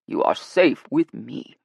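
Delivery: slow, loud, firm assurance. A narrator's reading. Guide reassuring the nervous women, firm. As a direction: space the words out with authority. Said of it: "Royce said firmly".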